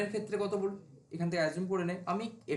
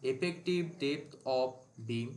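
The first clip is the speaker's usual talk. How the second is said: slow dictation; 4 semitones lower, much slower than usual. Shown as a demonstration. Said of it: "আজকে অনেকটা আস্তে আস্তে বলছি".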